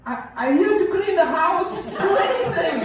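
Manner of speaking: very high, loud squealing panic; mock terrified plea. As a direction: plead for your life in a squeak. Frightened cleaning lady pleading.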